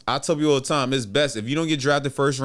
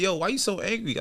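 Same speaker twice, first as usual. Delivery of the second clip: retold puzzled challenge; 7 semitones higher, 4 dB quieter than usual. Opponent questions his anger.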